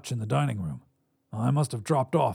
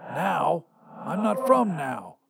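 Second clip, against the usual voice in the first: higher-pitched raspy voice; 5 semitones higher, slower than usual. A narrator's reading. Visitor wakes his past self, raspy. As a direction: rasp it out as an older double of yourself. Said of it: "though a bit raspy".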